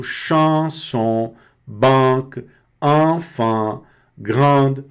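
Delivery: "chanson, banque, enfant" all nasal; well nasalised. Shown as a demonstration. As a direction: make each word strongly nasal. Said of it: "tenta bem nasalado". (tudo bem nasalado)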